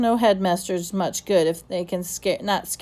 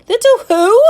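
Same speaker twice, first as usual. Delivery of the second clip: "Little who?" leaping very high and loud; shocked disbelief at the answer; incredulous echo; 12 dB louder than usual. Acted. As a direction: headmaster baffled by a strange name, incredulous. Puzzled headmaster echoes oddly.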